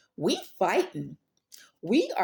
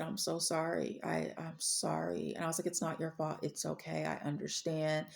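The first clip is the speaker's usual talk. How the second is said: softer, through held-back tears; teary and quiet; 8 dB quieter than usual. Retold or imitated. In a hushed, teary voice, consoling the sorry bank lady. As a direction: let the words come out hushed while the tears fall. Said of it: "tears just start falling from my eyes".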